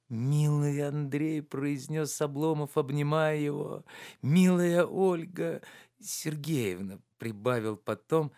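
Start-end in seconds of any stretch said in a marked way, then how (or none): 0.1-1.4 slow, moved, a touch of tears; tender, near tears. A narrator's reading. Oblomov embracing his friend, slow and moved. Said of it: "во взгляде его уже было умиление и слёзы". 4.2-7.0 slow, moved, a touch of tears; tender, near tears. A narrator's reading. Oblomov embracing his friend, slow and moved. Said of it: "умиление и слёзы".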